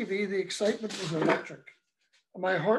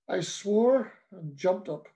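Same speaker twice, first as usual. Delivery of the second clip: ordinary delivery, nothing marked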